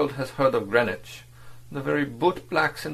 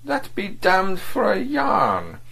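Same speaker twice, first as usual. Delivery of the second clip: pitched-up, slow, astonished cry; astonished exclamation; 11 semitones higher, 4 dB louder, slower than usual. A narrator's reading. Diplomat astonished his agent is married, exclaiming. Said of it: "exclaimed ... unfeigned astonishment".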